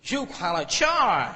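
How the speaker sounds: imitating the MD's stylish American accent. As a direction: deliver it slowly in a cool American accent. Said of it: "style से बोलते बल्कि".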